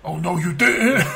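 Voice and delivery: slow sassy "oh no you didn't"; drawn out with attitude; sassy mock retort.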